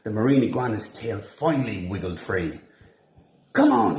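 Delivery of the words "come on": high, loud urgent cry. A narrator's reading. Escaping iguana urging his friends, high loud cry. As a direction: cry out to flee. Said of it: "he cried".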